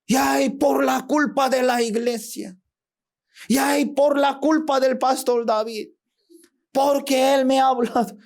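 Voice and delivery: high, whining complaint; whining blame. Acted. Churchgoer blaming the church and pastor, high and whining.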